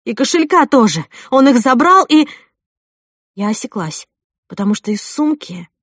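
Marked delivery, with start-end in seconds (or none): 0.0-2.3 loud, high, triumphant rush; raised voice, breaking off mid-claim. A narrator's reading. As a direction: press the proof home loudly. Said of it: "Мой голос торжествующе дрожал".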